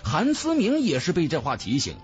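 ordinary delivery, nothing marked

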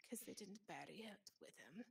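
hushed, eerie whisper for the punchline; spooky whisper. Acted. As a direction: whisper it like a ghost story secret. Spooky punchline of ghost story.

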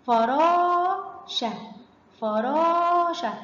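very slow فراشة, the raa stretched high; long vowel demo. Shown as a demonstration. Teacher models a madd word for pupils, drawn-out فراشة. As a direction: stretch the alef in فراشة, then drop onto شة. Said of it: "سمعنا صوت المد".

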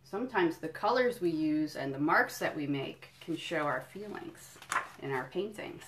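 normal talking voice

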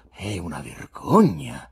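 slow, pronouncing judgment; a slow, grave verdict. A narrator's reading. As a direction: deliver it like a sentence from the bench. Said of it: "sentenziò l'ospite".

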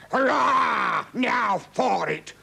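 high, loud hurrah, stretched out; exultant shout. A narrator's reading. Count cheering on the lions from his box, shouting. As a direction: shout it out like a bloodthirsty spectator. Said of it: "Count Hoginamo shouted".

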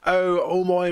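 high, fumbled mock Irish; attempted Irish accent. Shown as a demonstration. Fan still searching for an Irish accent, badly faked. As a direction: make a faltering stab at an Irish accent. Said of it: "how to do an Irish accent".

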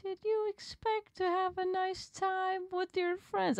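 high, slow falsetto cartoon villain taunt; HIM from Powerpuff Girls. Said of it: "sound like him from the Powerpuff Girls".

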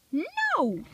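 a high, short squeaked no. A narrator's reading. Bill refusing the diesel with a high squeak. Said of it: "squeaked Bill".

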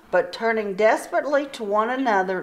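ordinary delivery

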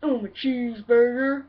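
lightly put-on talking burger; cheeseburger impression. Comedian introducing himself as fast food, lightly acted cheeseburger. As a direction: introduce yourself as a mock burger. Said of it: "next impression is on a cheeseburger".

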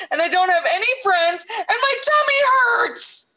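put-on high, wailing little-kid tirade in a breathless run-on; crying child's rant. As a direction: pour out every grievance, building to a wail. Voicing crying boy's long tearful rant.

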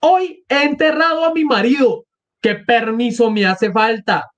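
acted outcry, pitched high; indignant cry. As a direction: wail it high as an outraged widow. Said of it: "Gritó Katerina Ivanovna".

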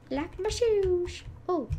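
light up at the shoes; gleeful, slow remark; excited. Real, not acted. Excited about seeing shoes.

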